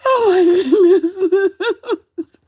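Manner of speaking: high, drawn-out words through laughter; squeaking with real laughter; helpless laughter. Real, not acted. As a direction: laugh so hard the words barely come out. Overcome with laughter at chat.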